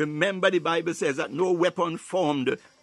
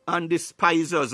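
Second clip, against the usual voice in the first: ordinary talk, nothing marked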